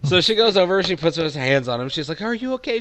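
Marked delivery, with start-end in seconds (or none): 2.2-2.8 higher, gentle checking-in voice; acted higher voice of concern; concerned lady. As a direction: play a woman checking on someone hurt. GM voices lady comforting hurt man.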